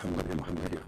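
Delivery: eerie angel voice. Acted. Angel's voice coming to Muhammad, hushed eerie call. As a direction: call softly as if from beyond. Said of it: "The voice goes".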